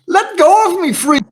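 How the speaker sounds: loud, high-pitched panicked shriek; panicked scream. Acted. Panicked bystander screaming at the player who grabs them, high shriek. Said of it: "looking at you panicked and screams".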